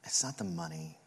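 low, soft, halting retelling; hushed, understated mimicry of a mom near tears; tearful mother. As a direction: speak tenderly with long pauses, holding back emotion. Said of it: "tearing up and beginning to weep".

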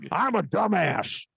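high raspy ranting host; Alex Jones voice. Acted. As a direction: bark it like a radio host. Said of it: "gonna hurt your voice".

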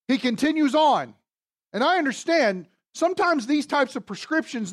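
fervent pulpit exhortation; preaching at full force. Acted. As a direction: preach it up, rousing the room. Impassioned preaching on Romans.

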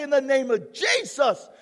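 loud firm rebuke of the devil; firm command. Shown as a demonstration. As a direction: say it with a firm stance. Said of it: "take a stance and say".

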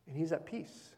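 soft reassurance. Acted. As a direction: settle the room gently.

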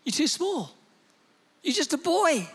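put-on a king shutting the boy down; a hint of a blunt old king; King Saul. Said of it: "King Saul says".

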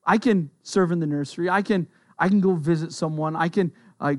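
ordinary talk

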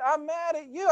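put-on high, childish indignant complaint; little boy, indignant. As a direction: pout it out like a six-year-old. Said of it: "He said".

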